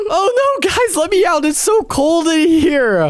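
high, laughing pleas to be let out; mock trapped. Boy stuck in freezer begging friends, laughing panic. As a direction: beg through giggles to be freed.

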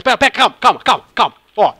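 loud, urgent repeated calma; frantic gamer pleading for time; panicking gamer. Real, not acted. Dying in game, urgent pleas.

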